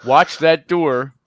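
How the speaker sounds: slow, loud warning shout; yelling. Acted. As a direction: bellow the warning slowly at them. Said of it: "that's what I'm yelling".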